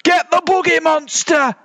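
littlest bear's rallying yell; squeaky-high battle order; high-pitched bellow. A narrator's reading. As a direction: bellow it high, like a small ringleader. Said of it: "bellowed the littlest one from the back".

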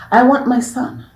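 cold fury. Acted. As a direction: spit it with hatred. Stepmother reveals hatred.

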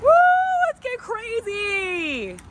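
high, drawn-out party whoop; rowdy party animal. Pretend. Cheering about open containers.

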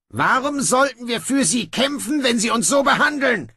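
high, indignant dwarf voice; defiant little fighter pushing back. Acted. Dwarf protesting to Snibral, high indignant voice.

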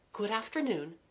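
high, soft farewell; kindly girl. Acted. Kathleen bidding the young man good afternoon, soft and high. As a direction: pitch it up and keep it gentle.

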